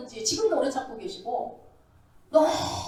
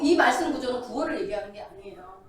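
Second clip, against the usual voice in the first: ordinary delivery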